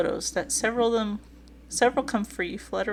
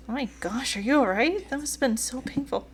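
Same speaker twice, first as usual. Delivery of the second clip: higher-pitched worried listener; concerned reply; 5 semitones higher than usual. Acted. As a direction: voice a gasp of sympathy, pitched up.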